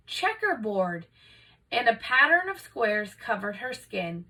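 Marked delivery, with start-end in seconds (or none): none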